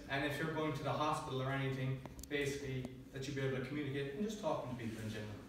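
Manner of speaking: ordinary talk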